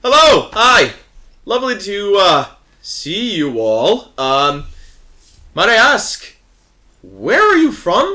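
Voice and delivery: high, loud, overly cheery greeting; guard character voice. Acted. Guard hailing the ship crew, bright and loud. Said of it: "Piscatilian guard goes".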